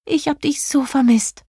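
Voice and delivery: high, tender fairy delight; joyful reunion in a high-pitched voice; overjoyed. A narrator's reading. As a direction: pour out relief, pitched high. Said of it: "rief Jelina überglücklich".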